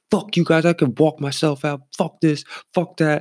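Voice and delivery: high-pitched mock belligerence; retold flipping out. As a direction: imitate a drunk guy kicking off. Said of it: "He just starts flipping out".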